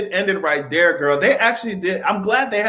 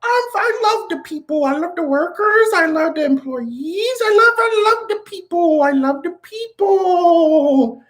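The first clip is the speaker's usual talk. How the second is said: high, drawn-out mock politician voice, pitched way up; hammed-up Macron, stretching each line higher; 13 semitones higher, slower than usual. Mocking Macron's speech to the people, high and slow. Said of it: "that's what Macron".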